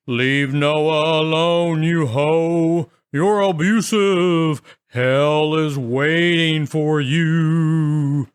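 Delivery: haunt her with a slow spooky moan; ghostly voice. Retold or imitated. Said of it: "changed my voice to sound more ghostly like".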